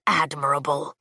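low, cool, lordly dragon; deep dragon voice. Acted. Dragon Leothan speaks.

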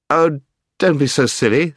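loud, high exasperation; exasperated. A narrator's reading. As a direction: snap it out impatiently. Scrub fighting the enchantment.

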